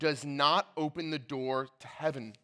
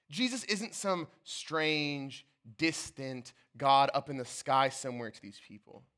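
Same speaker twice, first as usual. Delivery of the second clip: normal talking voice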